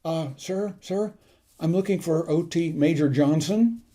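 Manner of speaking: soft, hesitant opening, imitating a nervous underclassman; meek junior cadet asking for someone; timid. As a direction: timidly address a superior officer. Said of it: "very timidly he said".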